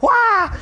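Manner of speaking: high, loud playful yell; hammed-up high yell; mock battle cry. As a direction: yelp it like a cartoon attack. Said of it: "I would have been so mad".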